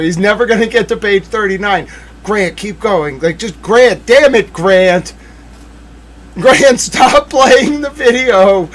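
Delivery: high, loud pleading at the screen; exasperated urging. Real, not acted. Streamer to her producer Grant, loud and exasperated. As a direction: beg the operator to keep going. Yelling at video playback.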